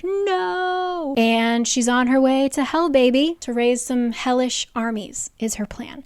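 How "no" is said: high, sharp cry of no; outraged villain shouting No; villain's cry. Retold or imitated. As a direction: yell it as the villain loses. Villain Rigolis cries out.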